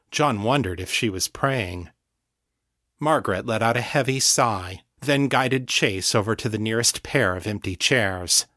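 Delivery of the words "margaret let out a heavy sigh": usual speaking voice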